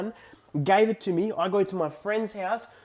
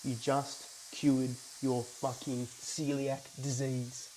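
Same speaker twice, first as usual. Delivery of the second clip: soft, slow inner voice; a quiet little voice in his head; 4 semitones lower, 9 dB quieter, slower than usual. Retold or imitated. Telling himself about his own recovery, soft and slow. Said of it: "A little voice in my head".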